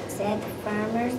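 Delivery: ordinary delivery, nothing marked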